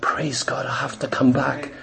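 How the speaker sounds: low, hushed aside to God; retold glad relief. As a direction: drop right down, soft and private. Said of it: "I was so glad".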